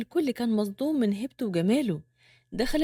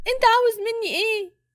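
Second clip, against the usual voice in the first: high-pitched frightened demand; panicked woman, voice far up; terrified; 9 semitones higher, 4 dB louder than usual. A narrator's reading. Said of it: "بصت له برعب أكتر". (برعب وبصوت رفيع)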